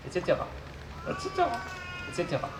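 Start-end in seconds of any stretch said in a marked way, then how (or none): 1.0-1.5 high robot voice with garbled consonants. Acted. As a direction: mangle the consonants like a glitchy robot. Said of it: "souffre d'une pathologie vocale".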